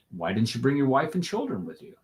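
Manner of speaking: normal talking voice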